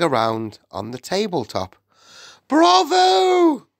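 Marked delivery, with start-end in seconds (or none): 2.5-3.7 very high, loud, drawn-out bravo; yelled cheer. A narrator's reading. Audience of witches applauding the spell, yelling. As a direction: yell it like an adoring crowd. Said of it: "yelled the audience".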